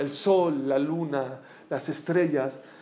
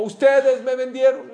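mock accusation; 8 semitones higher, 8 dB louder than usual. Imagined Joseph accusing his brothers.

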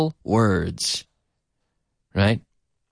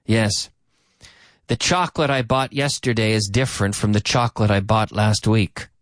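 ordinary talk

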